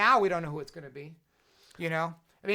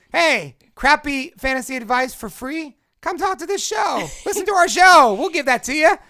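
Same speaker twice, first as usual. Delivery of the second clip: hyped-up promo; 9 semitones higher, 11 dB louder than usual. Acted. Hyping the show like an ad.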